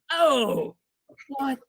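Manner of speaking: high, loud mock indignation; stunned, offended retold outburst; outraged coach. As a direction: imitate an affronted coach, pitch soaring.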